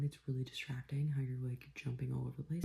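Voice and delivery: soft whispered telling-off; whispered scolding. Real, not acted. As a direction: hush the dog, whispering. Scolding dog in hushed voice.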